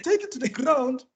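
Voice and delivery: put-on high, laughing African-accent parody; mock African accent. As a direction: keep the hammed-up African accent going. Continuing the accented quote.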